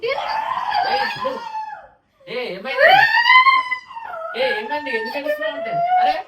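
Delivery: very high frightened calling; panicked high calls. Acted. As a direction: squeal in mock panic.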